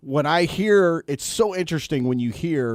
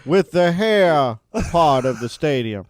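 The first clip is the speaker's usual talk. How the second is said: mock old coach; 6 dB louder than usual. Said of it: "parodying the coach's speech".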